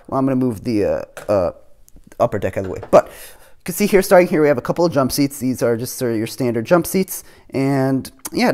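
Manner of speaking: ordinary talk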